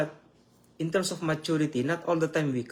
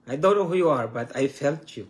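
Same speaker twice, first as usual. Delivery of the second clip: ordinary talk, nothing marked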